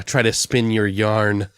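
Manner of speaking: usual speaking voice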